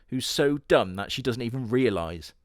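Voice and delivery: ordinary talk, nothing marked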